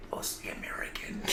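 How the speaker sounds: very soft, voiceless whisper; mock-secret whisper. Joking secret confided to guests.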